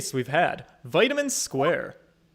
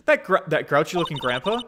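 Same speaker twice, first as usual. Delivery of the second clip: usual speaking voice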